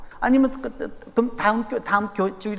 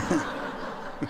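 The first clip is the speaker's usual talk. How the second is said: breathy laugh; 6 semitones lower, 11 dB quieter than usual. Real, not acted. Laugh after his joke.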